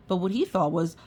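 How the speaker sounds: ordinary delivery, nothing marked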